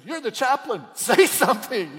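high, loud, pressing; mother urging the chaplain loudly; urgent demand. Retold or imitated. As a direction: push him to speak. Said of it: "the mother leaned over at me and said".

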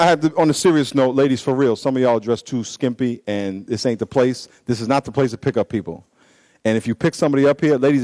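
usual speaking voice

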